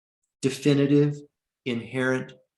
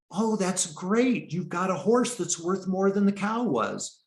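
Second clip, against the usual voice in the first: excited neighbors; 8 semitones higher than usual. Retold or imitated. Neighbors cheering the old man's luck, mock delighted.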